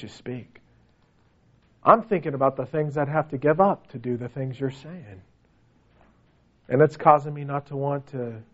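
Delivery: low, subdued confession; reluctant listener. Acted. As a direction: drop low as a weighed-down churchgoer admitting doubt. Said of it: "or could you say".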